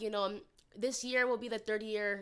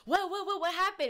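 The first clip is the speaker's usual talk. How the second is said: alarmed mom; 8 semitones higher than usual. Retold or imitated. Mom on the phone alarmed, pitched up and panicky. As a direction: panic as a mother fearing bad news.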